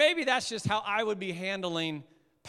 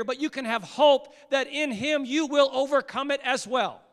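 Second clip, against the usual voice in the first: louder, higher preaching voice; impassioned preaching; 3 semitones higher, 5 dB louder than usual. Acted. Pastor proclaiming God sees their suffering, impassioned. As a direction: preach it hard to the room.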